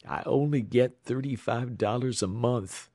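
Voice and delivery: ordinary delivery, nothing marked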